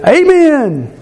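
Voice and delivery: a high, approving amen called out from the pew; mock hearty amen. Congregation agreeing with a sermon, high mock amen. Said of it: "and we say".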